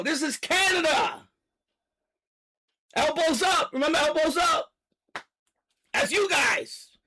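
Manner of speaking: heated shouting rant; angry rant. Real, not acted. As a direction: rant hard at the audience. Political rant at Canadians.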